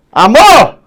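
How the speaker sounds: high, loud yell swelling on MOR; shouted at the gate. Shown as a demonstration. Teacher finding the stressed syllable, shouting at the gate. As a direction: holler "amor" as if calling from outside. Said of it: "O famoso grita no portão".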